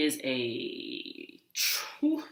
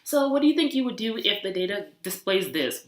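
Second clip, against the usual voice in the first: higher quizzing professor voice; imitating a condescending lecturer; mock professor; 5 semitones higher, 3 dB louder than usual. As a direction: grill her like a smug professor. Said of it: "questioned me like a professor".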